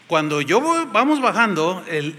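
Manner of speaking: ordinary talk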